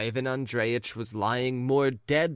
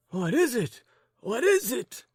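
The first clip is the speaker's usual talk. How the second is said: high, loud alarmed questions; alarmed cry; 13 semitones higher, 3 dB louder than usual. Acted. Old gentleman jumping up in alarm, high and loud. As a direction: demand it in alarm. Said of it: "cried the old gentleman".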